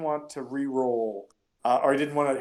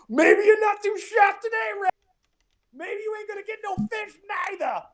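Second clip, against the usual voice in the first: much higher, shouted mocking jeer; lazy-eyed heckler; 4 dB louder than usual. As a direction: taunt him like a sneering barfly, high and loud. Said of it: "the guy with the lazy eye just".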